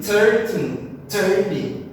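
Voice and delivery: "thirteen, thirty" both stressed on the first syllable; THIR-teen, THIR-ty, confusably alike; same stress on both. Shown as a demonstration. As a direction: make "thirteen" sound almost like "thirty". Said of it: "se a gente fosse colocar a mesma sílaba tônica".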